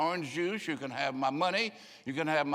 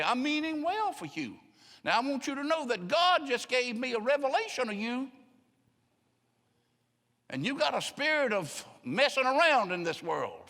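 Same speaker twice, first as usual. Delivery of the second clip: much higher sanctimonious put-on voice; mock pious voice; 9 semitones higher than usual. Preacher mimics a self-righteous churchgoer scolding someone, high and sanctimonious. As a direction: play the holier-than-thou rebuker.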